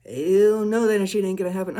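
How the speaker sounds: loud, sassy hell-no shout; defiant shout. Retold or imitated. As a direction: shut them down hard. Defiantly stepping into higher self.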